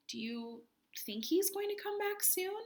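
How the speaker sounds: usual speaking voice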